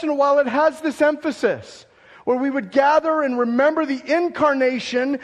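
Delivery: loud, high, fervent sermon delivery; preaching at full force. Acted. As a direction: preach it out to the whole church. Preaches Christmas and resurrection together.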